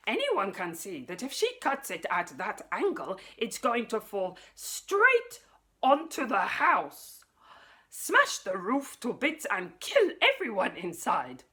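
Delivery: getting worked up about the falling tree; rising alarm. Acted. Alien visitor panicking about the tree, alarmed. Sputnik frets, alarm builds.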